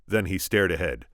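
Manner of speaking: plain reading voice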